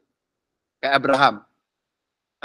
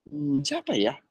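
John wondering; 10 semitones higher, 7 dB quieter than usual. Retold or imitated. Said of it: "si Yohanes ditanyain".

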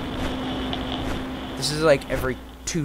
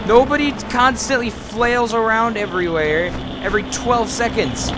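exasperated; 7 semitones higher than usual. Real, not acted. Player exasperated at restless sleeper.

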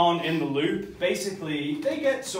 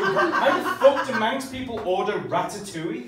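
ordinary talk

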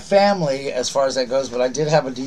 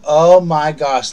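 loud, slow, awed oh my gosh; overwhelmed with delight; amazed gasp; 3 semitones higher, 9 dB louder, much slower than usual. Real, not acted. Opening a touching gift.

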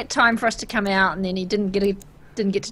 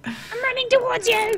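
panicked squeal. Real, not acted. Fleeing dog in game.

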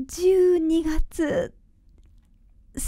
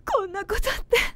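crying; 4 semitones higher, much faster than usual. Acted. Mother realizes, breaks down crying.

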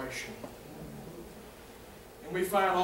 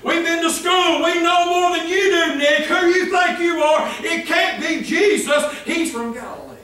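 higher, louder taunting of Nick; arrogant scorn; 7 semitones higher, 6 dB louder than usual. Retold or imitated. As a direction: belittle Nicodemus with contempt. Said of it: "Again, in their arrogance".

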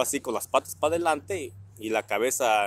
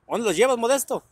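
high, louder call across the street; neighbor calling out; 10 semitones higher, 5 dB louder than usual. Retold or imitated. Neighbor don Chicho calling out to dad with his kids, high and loud.

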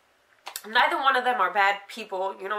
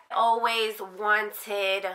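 ordinary talk

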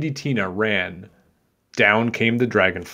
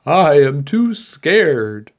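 slow, loud, higher-pitched storybook fright; scared candlestick maker; 6 semitones higher, 8 dB louder, much slower than usual. A narrator's reading. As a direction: draw out the fearful excuse. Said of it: "The candlestick maker said".

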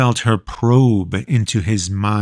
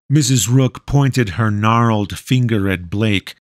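ordinary delivery, nothing marked

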